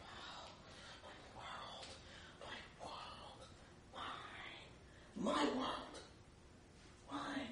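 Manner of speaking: whispered, breathy awe over my world; hushed awe. Retold or imitated. Selfish self whispering over a tiny world, hushed. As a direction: whisper it like a treasure. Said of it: "And I am like".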